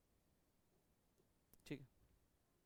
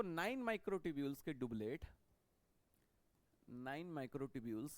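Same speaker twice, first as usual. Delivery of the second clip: slow dictation; much slower than usual. Shown as a demonstration. Teacher dictating notes slowly.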